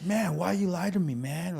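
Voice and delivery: put-on high, indignant kid complaint; angry retold protest. Kid brother feeling lied to about Santa, angry retold protest. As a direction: act out an aggrieved little brother, pitch jumping up. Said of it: "I immediately got angry".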